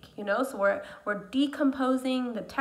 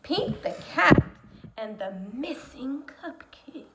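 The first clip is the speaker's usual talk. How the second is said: dramatic title read; 2 semitones higher than usual. Acted. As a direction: announce it, then drop into a whisper.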